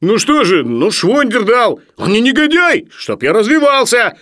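very high, loud protest; defensive outburst. Acted. Sharikov defends the man who lent the book, shouting. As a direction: yell back in self-defence.

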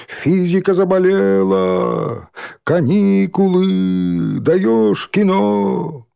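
drawn-out gleeful yelling. A narrator's reading. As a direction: shout it slowly, gloating. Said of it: "орали, перебивая друг друга".

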